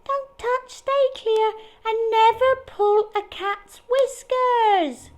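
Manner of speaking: high, chanted chorus of little mice; yelling mice. Acted. Little mice reciting the safety rule, high and slow. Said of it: "they yelled so loudly".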